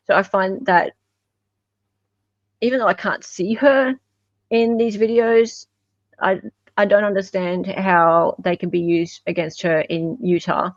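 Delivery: ordinary talk, nothing marked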